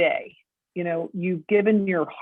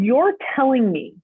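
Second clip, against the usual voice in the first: high, louder incredulous question; retelling the moment it sank in, voice climbing on people; shocked disbelief; 6 semitones higher, 5 dB louder than usual. Retold or imitated. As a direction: spell out the horror that people are sold. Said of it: "I said".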